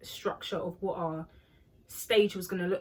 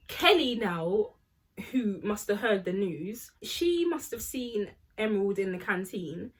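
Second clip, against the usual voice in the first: ordinary talk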